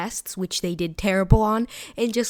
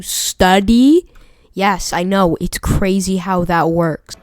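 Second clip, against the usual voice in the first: loud mock amazement; sarcastic outburst; 11 dB louder, slower than usual. Real, not acted. YouTuber scolding failing students in film, loud sarcasm. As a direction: belt it out as if explaining the obvious.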